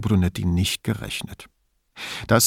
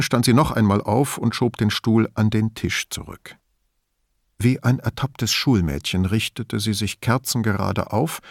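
ordinary delivery

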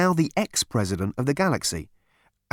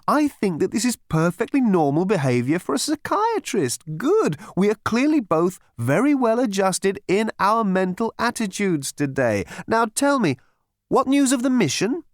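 much higher, sing-song soothing voice; chirpy psychiatrist; 8 semitones higher, 3 dB louder than usual. A narrator's reading. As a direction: purr reassurance to a violent patient. Psychiatrist speaking.

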